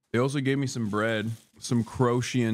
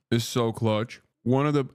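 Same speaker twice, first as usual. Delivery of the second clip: ordinary talk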